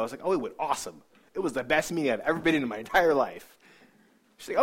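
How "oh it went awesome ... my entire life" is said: loud, overdone gushing; mock-thrilled, punched-up delivery; fake enthusiasm. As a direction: oversell it to cover a lie. Lying to mom about the meeting.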